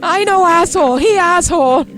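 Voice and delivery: put-on high Vietnamese-accented protest; hammed-up indignant Vietnamese accent; mock Vietnamese accent. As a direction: imitate an aggrieved man in a Vietnamese accent. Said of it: "doing their best Vietnamese impression".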